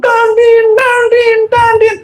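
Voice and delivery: very high, repeated horn blasts; imitated impatient honking. Impatient driver in a jam, imitated high honking. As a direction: mimic a car horn with a high, insistent voice.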